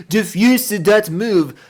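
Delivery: slow, drawn-out fake French reading; mock French accent. Streamer repeating the misspelt name in a light mock French voice. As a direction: pronounce it like a French word.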